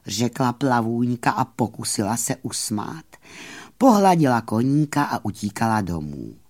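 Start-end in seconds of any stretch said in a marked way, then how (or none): none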